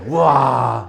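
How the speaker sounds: loud slow drawn-out wow; mock amazement. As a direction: fake being blown away. Teacher mock-amazed at problem.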